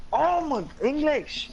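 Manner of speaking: high, loud, fed-up outburst; exasperated. Real, not acted. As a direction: cry out at your own tongue-tie. Frustrated at own tangled words.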